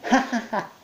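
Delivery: high, spelled-out ha ha ha; mock laugh. Monkey laughing pointedly at Mike. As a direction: say the laugh as words. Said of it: "underlining the fact that he was laughing".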